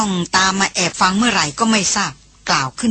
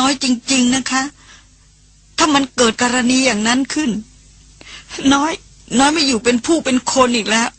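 higher, pleading through a mild sob; a hint of a young woman weeping as she begs; crying aloud; 5 semitones higher than usual. Younger sister pleads with her brother, crying. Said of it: "ร้องไห้เสียงดัง".

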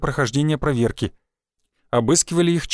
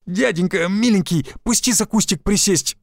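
plaintive whine; 5 semitones higher than usual. Acted. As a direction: beg like a pitiful kid. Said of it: "жалостливо протянул я".

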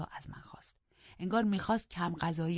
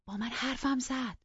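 stunned; 9 semitones higher than usual. A narrator's reading.

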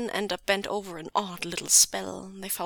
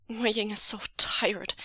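usual speaking voice

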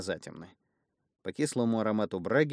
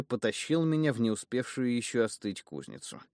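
ordinary delivery, nothing marked

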